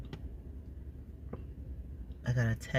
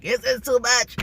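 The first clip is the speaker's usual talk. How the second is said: body overwhelmed; 11 semitones higher, 7 dB louder than usual. Retold or imitated. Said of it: "my body is like".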